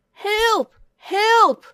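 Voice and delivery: high, loud fake cry of help; shouting for help. A narrator's reading. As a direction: yell for help down the hill. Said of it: "he shouted".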